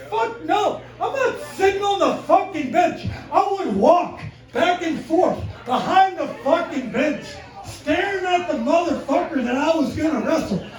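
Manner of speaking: high, loud, worked-up stage delivery; shouted stand-up storytelling. Acted. Comedian telling crowd of wrestling days, shouted and fired up. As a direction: tell it fired up, punching each phrase.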